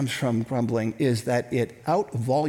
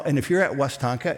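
normal talking voice

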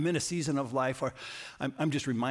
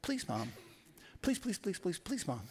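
put-on pleading child; 6 semitones higher, 5 dB quieter than usual. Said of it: "he stayed sweet a little bit".

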